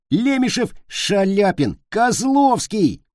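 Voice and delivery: high-pitched chant of names; boys yelling. A narrator's reading. Three boys bouncing on beds, yelling rival singers' names. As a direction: call the names out as shrieked battle cries. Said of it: "и орут".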